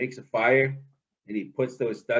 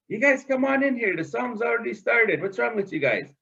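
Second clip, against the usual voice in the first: higher, quick coaxing call; coaxing trickster; 8 semitones higher than usual. Acted. Trickster urging the ducks to come in, higher and quick. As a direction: hurry them in, impatient.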